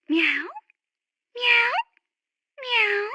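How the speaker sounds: soft, short little meows; imitated cat meow. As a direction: mew gently to coax a cat.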